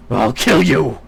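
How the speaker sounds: loud enraged threat; furious roar. Acted. Woodwose threatening the queen, furious roared threat. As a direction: scream the threat in fury.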